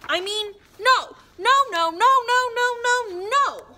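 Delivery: high loud repeated no; shouting no. Acted. Gnome shouts no at the reader, high and loud. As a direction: yell no over and over. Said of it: "There's no need to shout".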